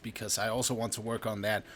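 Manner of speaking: normal talking voice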